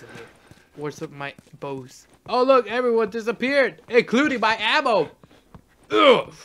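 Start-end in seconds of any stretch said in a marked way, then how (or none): 2.3-6.3 loud, raised exasperated complaint; frustrated sarcasm. Real, not acted. Player finding the ammo gone too, loud exasperated griping. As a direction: gripe loudly, ending in a disgusted ugh.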